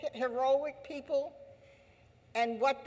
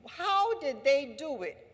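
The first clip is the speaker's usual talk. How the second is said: ordinary talk, nothing marked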